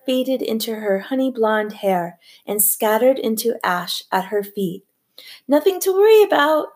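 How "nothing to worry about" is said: much higher, louder, fast and hoarse; hoarse repeat. A narrator's reading. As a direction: rasp it out, nerves fraying. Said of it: "she repeated hoarsely".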